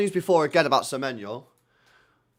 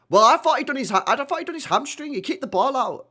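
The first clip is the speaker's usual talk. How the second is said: high, loud burst of alarm; 11 semitones higher, 7 dB louder than usual. Real, not acted. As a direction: blurt it out in sudden worry. Alarmed at a player injury.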